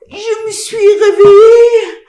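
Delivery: loud terrified cry; shouting in panic, slow and loud; panicked shout. Acted. Wakes up blind, panicking.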